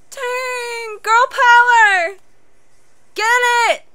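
high, drawn-out loud cheer; excited cheering. Real, not acted. Cheering on a character.

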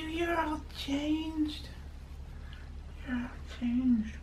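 high, very slow coo. Real, not acted. Cooing at baby.

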